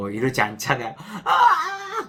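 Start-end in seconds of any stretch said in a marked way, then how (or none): 1.2-2.1 sharp high yelp of pain "아!"; a sudden loud "아!" as if it really hurts; shrill pained "아!"; cry of pain. Shown as a demonstration. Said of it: "그러잖아요".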